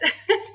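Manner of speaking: a bright, high burst of laughter; high giddy laugh. Real, not acted. Laughing after telling the boss she'll take the job. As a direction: laugh with happy relief.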